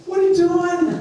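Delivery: very high-pitched incredulous question; mock disbelief. You turn around and catch them, mock incredulous. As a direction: ask it high, in comic disbelief.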